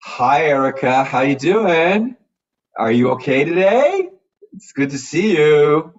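put-on high, loud, slow sing-song baby talk. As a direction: coo it like talking to a toddler. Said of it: "how we baby talk".

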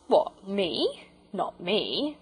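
mildly haughty porter, lightly voiced; a touch of lofty disdain. A narrator's reading. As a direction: play it gently aloof. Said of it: "said Perks loftily".

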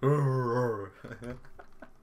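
amused laugh after own joke; real giggly laugh. Real, not acted. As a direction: crack yourself up. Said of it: "I'm in a laughy mood".

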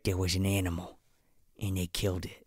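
low, very soft retelling; acted-out quiet, grave admission; mock hushed confession. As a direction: play a reluctant witness confiding quietly. Reluctant witness in retold exchange.

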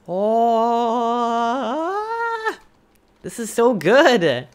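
loud, high thrilled praise; delighted. Real, not acted. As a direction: gush with delight. Delight at build.

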